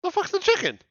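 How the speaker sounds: high, puzzled. Real, not acted. Can't find the chicken.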